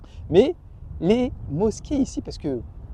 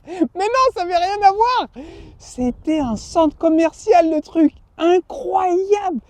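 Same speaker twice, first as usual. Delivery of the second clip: amazed; 11 semitones higher, 4 dB louder, slower than usual. Retold or imitated. Amazed at huge church.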